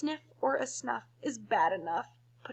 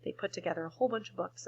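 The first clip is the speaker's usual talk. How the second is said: ordinary delivery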